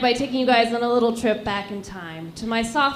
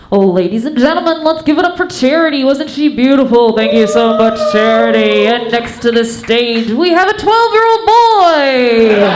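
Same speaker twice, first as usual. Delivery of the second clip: booming, very loud show MC; strip club announcer; 3 semitones lower, 12 dB louder than usual. Acted. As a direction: announce the next act at full volume, stretching the final words. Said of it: "It would sound a little like this".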